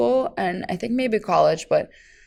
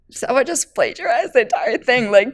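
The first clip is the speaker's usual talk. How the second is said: mock host family complaint; 5 semitones higher, 4 dB louder than usual. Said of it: "they were like".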